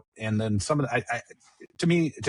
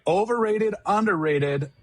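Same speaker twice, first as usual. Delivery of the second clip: high, bright segment announcement; announcer voice; 9 semitones higher, slower than usual. Acted. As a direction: announce the game like a host.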